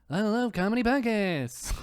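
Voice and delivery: playful imitation of an eager comedy fan; Mark Normand impression. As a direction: lightly mimic a comic's delivery. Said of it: "he sounds like Mark Normand".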